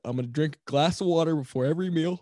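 words stretched by a yawn. Real, not acted. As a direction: say it mid-yawn. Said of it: "Sorry, I'm yawning right now".

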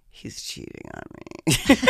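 mock-ominous low murmur; sudden dark suspicion. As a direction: drop low and slow like a grim realization. Retold panic on the plane.

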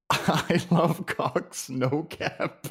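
high, slow, laughing through the words. Real, not acted. Streamer teasing co-host over the Cox plug, laughing. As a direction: crack up while getting it out. Joking innuendo, breaking into laughter.

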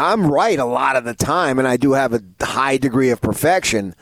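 normal talking voice

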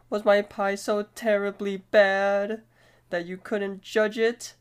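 high, put-on plaintive voice; mock-distressed high lament; wounded character voice. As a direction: wail the question in a high cartoon voice. Acting out Pops being eaten.

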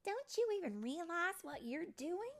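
high, insistent question; astonished fairy friend. Acted. Rosetta to Tink at the music box, high and incredulous. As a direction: point out what should be obvious.

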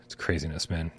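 sighed out, almost a whisper; weary aside. Real, not acted. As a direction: let it out low on a sigh. Sighing over chaos, then repeated.

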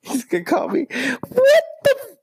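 shrieking high falsetto; squealing too high. Real, not acted. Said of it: "that's too high".

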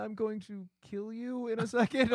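high, soothing voice to a pet. Retold or imitated. Consoling dying cat in car.

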